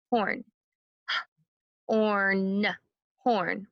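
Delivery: horn tapped as Orn then Horn; sound-by-sound tapping of horn; segmented sounds. Shown as a demonstration. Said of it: "Let's try it".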